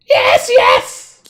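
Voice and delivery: loud creature yelp; cowed kobold. Acted. Kobold agreeing to the dwarf's threat, cowed yelp. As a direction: answer in frantic obedience.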